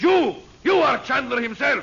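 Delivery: villain bellows his discovery; angry shout. Acted. Villain Roxor unmasks the disguised hero, angry shout. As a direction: shout it in furious recognition.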